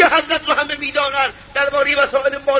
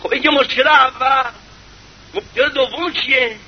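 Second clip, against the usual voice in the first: ordinary talk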